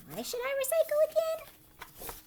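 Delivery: put-on high, whiny kid question; child voice. Girl asking the teacher again in a high childish voice. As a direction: stretch it out like a puzzled child.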